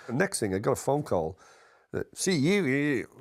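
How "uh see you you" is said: high, quick mock Scottish greeting from Kenny; Kenny's Scottish accent. On the phone, [name] mimics Kenny's greeting in a high mock Scottish accent. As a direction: put on a Scottish accent, pitched well up, for a phone hello. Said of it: "that Scottish accent".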